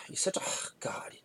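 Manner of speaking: hushed whisper under the breath; sighing whispered mutter; whispered aside. Real, not acted. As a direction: whisper the insult so mom can't hear.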